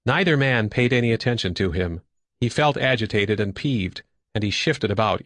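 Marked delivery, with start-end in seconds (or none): none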